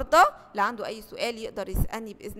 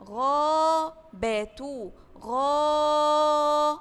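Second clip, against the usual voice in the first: stretched out slowly; 3 semitones higher, 4 dB louder, much slower than usual. Shown as a demonstration. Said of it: "الكلمة اللي بعدها".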